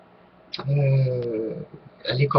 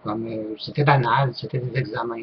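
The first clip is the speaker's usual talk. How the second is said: ordinary talk, nothing marked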